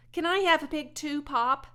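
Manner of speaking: high, loud eager plea; boy asking father for a pig. A narrator's reading. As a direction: beg hopefully.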